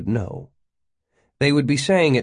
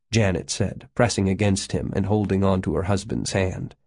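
ordinary delivery

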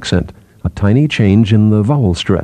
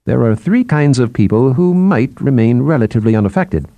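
animated, higher explaining voice; lightly voiced psychologist; 6 semitones higher than usual. Psychologist lists who might stay unaffected, lightly animated. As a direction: lay out the cases with lifted pitch.

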